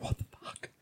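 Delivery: voiceless muttered disbelief; baffled whisper. Retold or imitated. Cashier baffled by the customer, whispered aside. Cashier's private reaction, retold.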